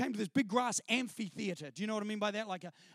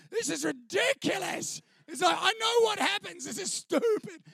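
higher, louder mock outrage that builds to a shout; hammed-up disbelief, pitched up and loud; mocking scorn; 8 semitones higher, 3 dB louder than usual. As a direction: scoff at the skit to the guys beside you. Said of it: "And I'm watching it going".

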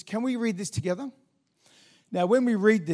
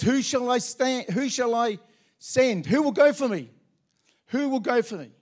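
higher, louder booming call; God calling out; 6 semitones higher, 4 dB louder than usual. Acted. Preacher voicing God calling for a messenger, high and loud. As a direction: call out as God searching for someone. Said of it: "God calls out".

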